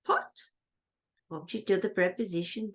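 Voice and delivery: ordinary talk, nothing marked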